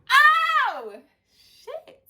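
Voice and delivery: loud, very high shout of amazement; excited shout. Retold or imitated. She realizes she read the man's mind, very high excited shout. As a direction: yell it like you just found a superpower.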